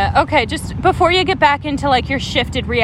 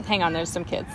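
hushed hang on; hushed aside; 7 semitones lower, 9 dB quieter than usual. Real, not acted. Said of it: "There's kids going by".

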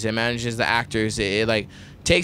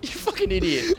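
high-pitched incredulous roast; laughing mockery. Real, not acted. Friend teasing over a misspelled hat, high laughing mockery. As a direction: crack up while ribbing a friend, voice shooting way up.

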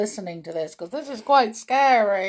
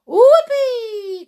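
very high, loud cheer; whoopee cry; 11 dB louder than usual. Acted. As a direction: whoop with wild delight. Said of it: "cried the witches".

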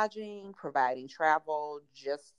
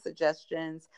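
ordinary talk

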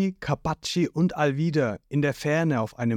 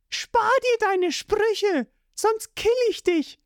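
much higher, slow menacing voice; threatening; slower than usual. A narrator's reading. Nami grabbing Zorro's throat, high and threatening. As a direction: threaten him high and hard.

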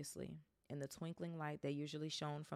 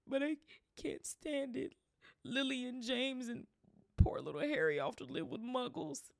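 sobbing; 9 semitones higher, 8 dB louder than usual. A narrator's reading. Hagrid sobbing over Harry.

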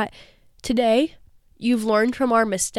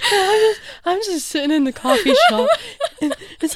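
laughing; 10 semitones higher than usual. Real, not acted. Laughing retelling the call.

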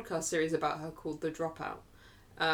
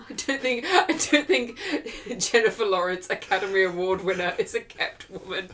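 laughing disbelief; 7 dB louder than usual. Real, not acted. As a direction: protest through real laughter. Laughing at co-host's "kept woman" remark.

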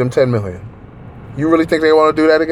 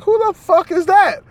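shocked. Retold or imitated. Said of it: "I was like".

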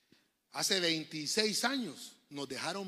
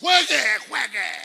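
loud, higher shouted order; referee's shout; 6 semitones higher, 16 dB louder, slower than usual. Retold or imitated. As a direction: yell it like a referee at a faker. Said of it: "cómo dice el árbitro". (grito de árbitro)

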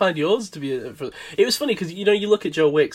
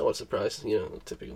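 low, soft sarcastic aside; mock grumble; 6 semitones lower, 8 dB quieter than usual. Host ribbing co-host for repeating his answer, mock grumbling. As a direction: grumble it under the talk, playfully put out.

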